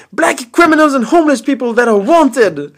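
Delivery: high, loud and fast angry lecture; angry rant. Retold or imitated. Angry uncle lectures nephew about Greyhound riders, loud. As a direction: scold him like a furious uncle. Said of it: "Så han blev skitarg".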